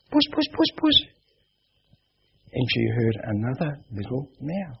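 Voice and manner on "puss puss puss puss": high sing-song kitty call; calling the cat. Retold or imitated. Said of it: "she thought she'd call out".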